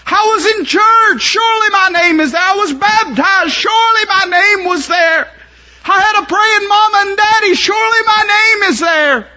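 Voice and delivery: high, loud crying plea to look again; wailing shouted plea; desperate pleading. Acted. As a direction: beg for your life at the throne. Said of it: "they'll say".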